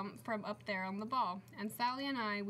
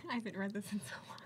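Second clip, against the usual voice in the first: whispered through a giggle; laughing aside; much faster than usual. Real, not acted. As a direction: giggle it out under breath.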